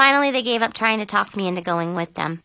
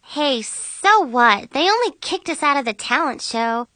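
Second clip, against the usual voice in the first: high, upbeat and playful; goofy cheerleading; 8 semitones higher than usual. A narrator's reading. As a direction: cheer the friends up with a silly grin. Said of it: "smiling goofily and doing her jazz hands".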